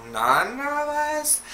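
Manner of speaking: slow, very high drawn-out denial; feigned innocence. Retold or imitated. Family denying they parked there, feigned innocence. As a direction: stretch it out high, playing innocent.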